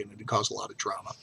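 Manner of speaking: usual speaking voice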